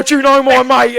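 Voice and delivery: imitating a pushy acquaintance; mock eager fan. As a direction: ham up an overexcited stranger.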